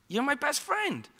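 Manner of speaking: ordinary talk, nothing marked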